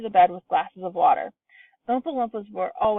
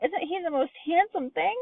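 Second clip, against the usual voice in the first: gushing; 9 semitones higher than usual. A narrator's reading. Gushing over father.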